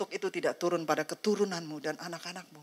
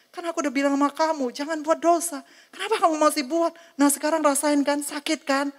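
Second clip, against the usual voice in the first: scolding God; 8 semitones higher, 4 dB louder than usual. Acted. God scolds Susan after punishment.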